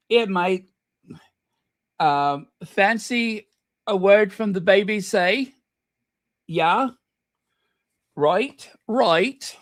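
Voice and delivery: bad English accent. Host joking about a word from the BBC, bad English accent. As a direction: ham up a terrible English accent. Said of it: "I do the worst English accent".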